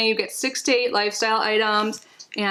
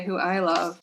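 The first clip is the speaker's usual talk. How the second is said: ordinary delivery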